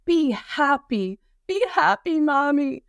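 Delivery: high-pitched mock yell of a small child; imitated toddler yelling. Mother imitating her toddler yelling at her, high mock yell. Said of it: "she's yelling at me".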